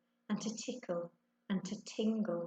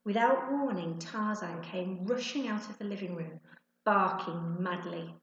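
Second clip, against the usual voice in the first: usual speaking voice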